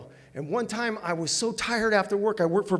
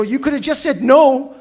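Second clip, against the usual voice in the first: loud, higher, wounded comeback; mock-offended reply, raised and loud; indignant retort; 6 semitones higher, 11 dB louder than usual. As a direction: fire back at the snub. Retorting to rejecting dancer.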